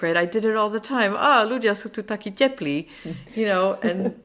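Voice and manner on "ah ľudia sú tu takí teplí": higher and louder, a friendly tourist compliment; cheerful Slovak compliment. Retold or imitated. Foreigner praising her hosts, proudly saying it in Slovak. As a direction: beam it out in eager learner's Slovak. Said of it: "to warm in Slovak with the classic mistake".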